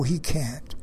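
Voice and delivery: normal talking voice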